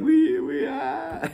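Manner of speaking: very high, stammering apostles at a loss; flustered stammer. Retold or imitated. Apostles Philip and Andrew stammering at the crowd, high flustered. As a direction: sputter like caught off guard. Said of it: "they're like".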